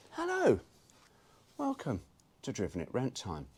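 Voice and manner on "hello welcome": sing-song hello. Real, not acted. Greeting viewers.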